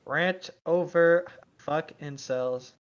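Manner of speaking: slow, deliberate, flat reading; slow deadpan readout. Pretend. Reading the post's sign-off to the chat, slow and deliberate. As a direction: draw out each word.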